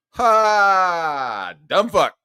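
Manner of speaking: loud, high mocking "Ha!" and jab; scornful shouted laugh. Reading own comeback to a rival, loud scornful crowing. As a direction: crow it at them, loud and high.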